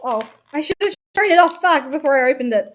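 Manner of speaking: catching her own mistake mid-sentence; annoyed little outburst; sudden realisation. Real, not acted. Realises she forgot to show it.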